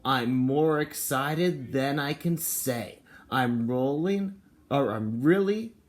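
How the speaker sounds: mild excited storybook voice; reading to children. Acted. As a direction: read it slowly with a bit of bounce. Reads picture book aloud.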